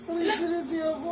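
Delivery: ordinary talk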